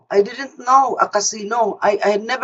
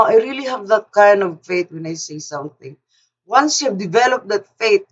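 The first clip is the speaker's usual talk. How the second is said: ordinary talk, nothing marked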